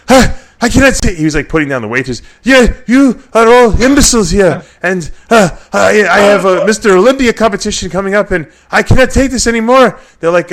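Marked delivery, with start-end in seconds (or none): 0.1-1.2 high, loud mock Nasser tantrum; impatient accented bodybuilder yelling; Nasser's outburst. Nasser voiced, angry at waiters. 2.4-10.0 high, loud mock Nasser tantrum; impatient accented bodybuilder yelling; Nasser's outburst. Nasser berates the waitress.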